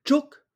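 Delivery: Tchouk high, short, sharp tone; Vietnamese chúc. Shown as a demonstration. Teacher drills Vietnamese syllable for class, high sharp tone. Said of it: "quel son entendez-vous?".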